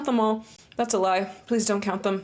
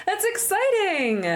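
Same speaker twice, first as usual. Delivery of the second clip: higher, slow gushing reaction; excited; 12 semitones higher, slower than usual. Real, not acted. Streamer gushing at a guest's good news. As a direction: gush over the good news.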